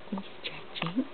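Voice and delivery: soft, almost under the breath; hushed narration. Real, not acted. Mother watching her baby stretch, hushed.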